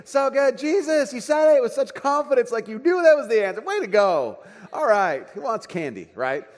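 high, loud, gushing praise; thrilled dad cheering his son on; overjoyed praise. Retold or imitated. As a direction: celebrate like he scored a goal. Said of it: "that kind of confidence".

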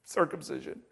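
dropped, deadpan Circumcision with a laugh under it; quiet punchline Circumcision. Shown as a demonstration. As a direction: let Circumcision fall flat and soft. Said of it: "Circumcision, really?".

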